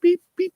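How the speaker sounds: high, light toots imitating a gentle car horn; polite little horn beeps. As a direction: chirp the beeps high like a timid horn tap. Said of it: "not like leans on a horn".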